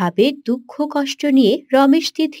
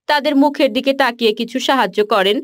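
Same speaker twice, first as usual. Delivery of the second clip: normal talking voice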